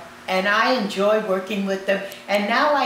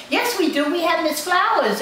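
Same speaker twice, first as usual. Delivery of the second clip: higher-pitched, eager retort; imitating eager nurses; 9 semitones higher than usual. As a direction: pipe up as the nurses correcting their boss. Nurses contradict the head nurse.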